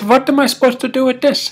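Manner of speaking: high, fast and baffled; baffled question. Acted. Man asking the plow driver about the dynamite, high baffled blurt. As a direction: blurt it out bewildered.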